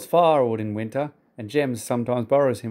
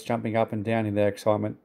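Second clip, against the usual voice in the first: usual speaking voice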